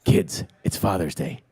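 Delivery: low, soft, slow deadpan line; Keanu as grim dad. Retold or imitated. Director imitating Keanu's first take to his screen kids, low and flat. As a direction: deliver it like a stone-faced hitman. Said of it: "Keanu's like".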